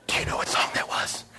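a hushed, secretive whisper; whispering to him at the dinner show. Retold or imitated. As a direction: breathe it as a quiet secret. Woman asks in a hush.

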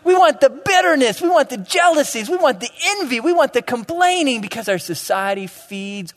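high, loud, hammered preaching cry; crying out what the audience craves. Real, not acted. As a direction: preach it out with full force.

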